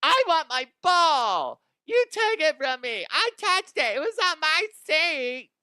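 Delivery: mock her like a spoiled two-year-old; whiny toddler demand. Mocking the ball-demanding fan in a high whining voice. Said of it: "demands a ball like a fucking two-year-old".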